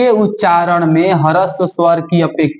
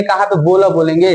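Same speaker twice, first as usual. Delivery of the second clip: ordinary talk, nothing marked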